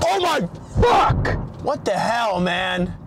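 screamed high and long, stretched out; raging at the car in real frustration; furious shout. Real, not acted. Driver hitting a curb, furious drawn-out shout.

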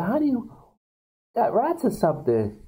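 high mock housewife question; lightly voiced wife asking about rats; falsetto wife. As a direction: lilt it up high and sweet. Wife calls to husband.

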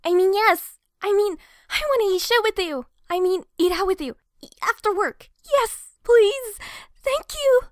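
loud, fast, tripping over every word; flustered blurting. Acted. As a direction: scramble to say yes without sounding weird. Flustered answer to invitation.

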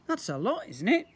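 a hint of a young boy; lightly voiced boy. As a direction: play young Ned, curious. Ned voice.

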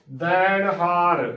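slow, loud ਦੈਣ ਹਾਰ with an open "dain"; full-voiced vowel. Shown as a demonstration. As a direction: draw out ਦੈਣ ਹਾਰ, a full "ai" in the first syllable. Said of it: "ਭਰ ਕੇ ਬੋਲਣਾ ਹੈ".

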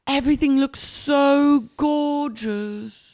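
high, slow, drawn-out gush; put-on boss gushing. Boss admiring her restaurant decorations, put-on gushing. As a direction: gush and stretch every word. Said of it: "She goes".

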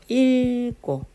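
읽고 with a long held ㄹ, as 일꼬; demonstrated pronunciation. Shown as a demonstration. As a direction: stretch 일 in 읽고, then tense 꼬. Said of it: "뭘로 발음한다고? 리을로".

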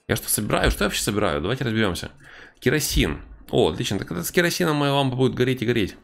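ordinary delivery

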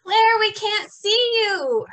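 high, loud call into the distance. Acted. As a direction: call out for a lost child. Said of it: "her family called".

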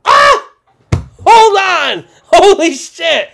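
high, loud shouts of disbelief; ecstatic screaming. Real, not acted. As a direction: yell like you just hit the jackpot. Still reacting to the pull.

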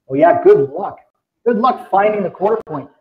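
ordinary talk

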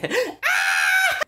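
high startled scream. Retold or imitated. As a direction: shriek at the sudden face. Scream at the ghost girl.

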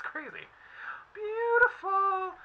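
high, slow, drawn-out swoon; delighted. Real, not acted. Reader marvels at the cards, high and drawn out. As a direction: let the word float up in awe.

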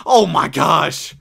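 slow, loud drawn-out disbelief; real dismay, dragged out; stunned groan. Real, not acted. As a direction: drag it out, floored. Stunned by the Lakers offer.

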